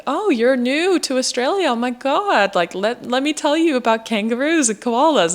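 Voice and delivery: high-pitched mock-excited welcome; imitating eager locals. Locals explain Australia to a supposed newcomer, mock-excited. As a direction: gush in a put-on high, swooping voice. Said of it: "so people think, like".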